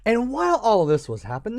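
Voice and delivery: ordinary delivery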